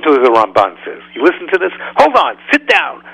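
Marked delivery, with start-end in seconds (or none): 2.0-3.0 excited buildup. Acted.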